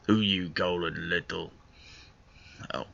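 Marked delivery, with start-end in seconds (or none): 0.1-1.5 S=Who are you calling little?; gruff dwarf voice. Acted. Character retorts to narration.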